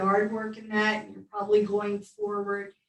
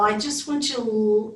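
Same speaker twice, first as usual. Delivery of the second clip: usual speaking voice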